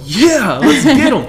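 mock eager agreement. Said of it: "And I'm like".

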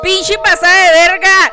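outraged shout. Real, not acted. Friend outraged at the story.

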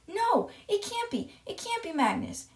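high-pitched acted denial; mock headmistress horror; horrified disbelief. Headmistress refuses to believe message.

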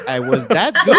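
high, laughing question; laughing disbelief. Retold or imitated. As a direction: laugh at your own surprise. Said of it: "I was like".

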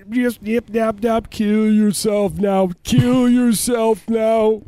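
high, slow eerie backwards voice; backwards speech. Pretend. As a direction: stretch it out like a reversed record. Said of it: "It's like, so scary".

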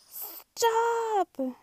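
high, loud shout of stop; high shrieking stop; yelled. Acted. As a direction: shout to halt the traffic. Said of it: "yelled Blue".

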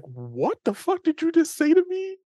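incredulous. Retold or imitated. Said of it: "made that face".

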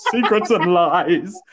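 loud, high mock-dramatic delivery; film character's line. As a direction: stretch out the title like a hammed-up line. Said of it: "one of the characters just goes".

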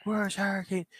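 high, half-volume retold scream; still screaming. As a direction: keep screaming it as he's dragged out. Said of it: "He's still screaming".

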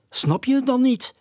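high, urgent screech of an owl; croaking owl. Acted. Owl insisting to his friend, urgent screech. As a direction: screech it out impatiently. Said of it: "kraste de uil".

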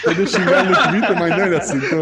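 laughing. Real, not acted. Laughing while joking about insults.

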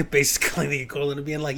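ordinary talk, nothing marked

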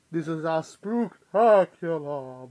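slow, loud horror-host voice; spooky announcer. Pretend. Halloween episode announcement, in mask.

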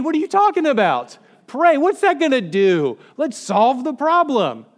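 high, loud acted protest; shouted disbelief, played up for laughs; exasperated outburst. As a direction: re-enact a frantic, incredulous objection. Acts out arguing with his mentor.

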